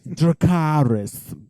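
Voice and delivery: high, drawn-out call; dramatic dragon command. Retold or imitated. Joking to co-host about the show, grand drawn-out command. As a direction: stretch it out like a grand battle cry.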